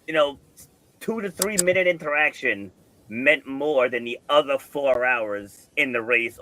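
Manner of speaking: ordinary talk, nothing marked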